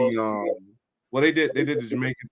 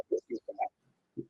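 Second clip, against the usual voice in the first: whisper; 13 dB quieter, slower than usual. Retold or imitated. Retelling reaction to bad movie.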